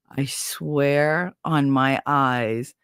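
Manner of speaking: very slow, weighted words; slow, earnest swearing, retold; solemn oath. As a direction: draw out every word of the vow.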